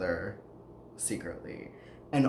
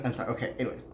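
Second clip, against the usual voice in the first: ordinary talk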